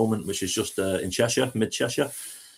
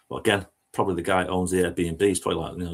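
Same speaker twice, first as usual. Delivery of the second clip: usual speaking voice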